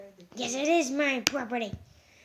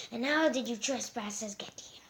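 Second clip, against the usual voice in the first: whispering; 6 dB quieter than usual. Pretend. Suspicious whisper to intruders.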